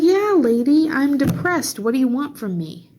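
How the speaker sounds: loud, high, fed-up comeback; exasperated retort. Retold or imitated. Snapping back at the lady who called her gloomy, loud and exasperated. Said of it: "It's like".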